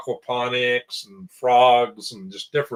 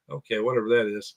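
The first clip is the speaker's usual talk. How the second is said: normal talking voice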